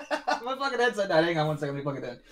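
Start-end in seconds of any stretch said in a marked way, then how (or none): 0.0-1.2 laughing. Real, not acted. Host finds his headset dead.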